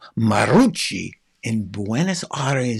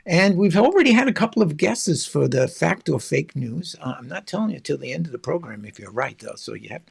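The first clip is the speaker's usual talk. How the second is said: ordinary talk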